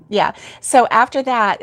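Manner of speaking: ordinary delivery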